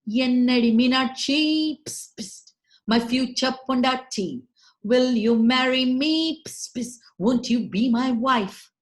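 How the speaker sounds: propose, hissing between lines; hissing lizard proposal. Acted. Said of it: "he too went".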